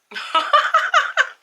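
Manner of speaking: shrieking giggle; high laugh. Real, not acted. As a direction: burst of high squealing laughter. Laughing at own mistake.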